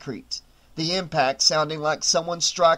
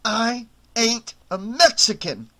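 through gritted teeth; 6 semitones higher than usual. A narrator's reading. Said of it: "through gritted teeth".

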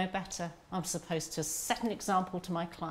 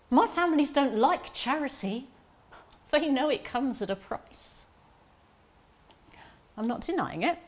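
heated defence; 5 semitones higher, 5 dB louder than usual. Acted.

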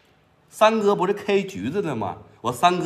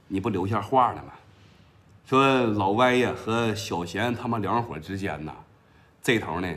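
lower, softer underling reporting to the boss; Huang Qiang's low voice; 8 semitones lower, 5 dB quieter than usual. Acted. The underling answers his boss in a lowered, deferential voice. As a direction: drop the voice down, a deferential henchman.

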